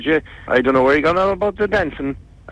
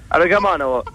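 ordinary talk

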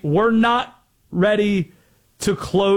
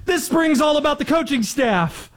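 imitating coach; 9 semitones higher than usual. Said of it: "caught himself ... saying".

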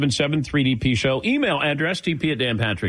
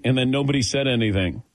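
ordinary talk

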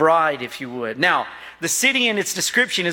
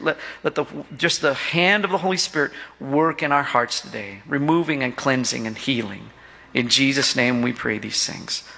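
hushed, lowered prayer voice; 4 semitones lower than usual. Real, not acted. Pastor praying over the church, low and earnest.

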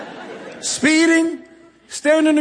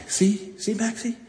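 muttering to himself; 2 semitones lower than usual. Retold or imitated. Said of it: "I was like".

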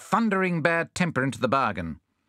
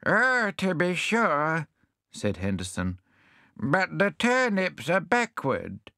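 higher, slow doddery voice; deaf hedgehog; 6 semitones higher, slower than usual. Acted. Deaf hedgehog asking the visitors to speak up. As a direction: plead for them to speak up.

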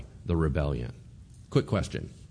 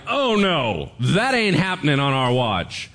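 defiant nation; 5 semitones higher, 6 dB louder than usual. Retold or imitated. Nation rising against taskmaster.

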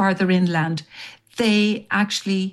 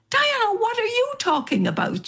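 puzzled friend. Retold or imitated. Friend baffled by her upset.

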